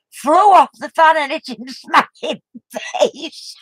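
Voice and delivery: can barely get it out for giggling; retelling the best bit while cracking up; laughing. Real, not acted. Knickers hit Ernie's face.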